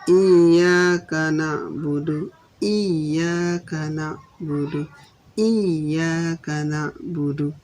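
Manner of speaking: Iyyaka with the yy pressed, slow; doubled y. Shown as a demonstration. Teacher chanting for students, slow stressed iyyaka. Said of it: "di ya-nya itulah tempatnya bertasdid".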